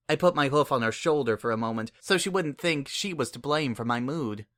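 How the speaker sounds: usual speaking voice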